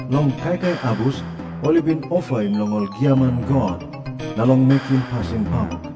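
ordinary delivery

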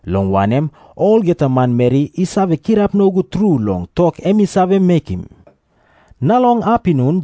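normal talking voice

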